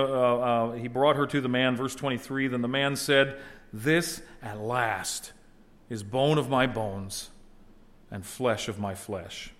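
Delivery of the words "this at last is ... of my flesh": slow, solemn reading of Adam's words; slow reverent reading. Acted. Adam's words read slowly to the congregation. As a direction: let each phrase land slowly.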